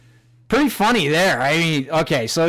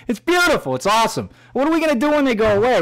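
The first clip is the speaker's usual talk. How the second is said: gleeful; 8 semitones higher, faster than usual. Real, not acted. Gloating about covid anger.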